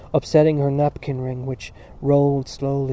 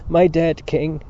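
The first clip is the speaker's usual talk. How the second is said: grieving, raised-voice lament; loud cry; 3 semitones higher, 7 dB louder, slower than usual. A narrator's reading. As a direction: cry it out in sorrow. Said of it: "he cried loudly".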